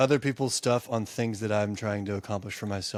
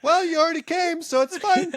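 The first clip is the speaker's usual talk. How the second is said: loud, high put-on voice; high skit voice; 5 dB louder than usual. Co-host answering in the phone-call skit, high silly voice. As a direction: squeal it like a comedy sketch.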